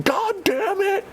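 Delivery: a high cry, dragged out slowly; exasperated curse. Retold or imitated. Soldier cursing at the rioting monkeys, in a high slow cry. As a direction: shout it in disbelief, slowly.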